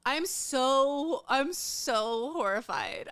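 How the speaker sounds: slow, dragged-out I'm so horrified; mock-dramatic slow despair; drawn-out horror. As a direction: stretch every word of I'm so horrified. Repeats horrified line slowly.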